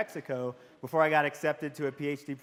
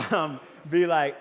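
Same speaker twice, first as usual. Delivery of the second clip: usual speaking voice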